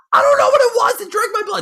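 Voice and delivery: very high, loud frantic cry; frantic high-pitched wail to the dispatcher; hysterical panic. Acted. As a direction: shriek it like a bitten victim. Bitten man panicking to dispatcher.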